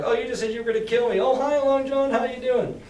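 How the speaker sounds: high-pitched nervous fake cheer; mock panicky. Scared boy greets the pirate, in mock high panic. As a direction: squeak out a jittery greeting. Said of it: "I was, like, freaking out".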